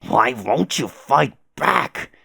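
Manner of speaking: slow, drawn-out desperate demand; anguished demand. Acted. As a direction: stretch out each word in frustration. Acted cry during a fight.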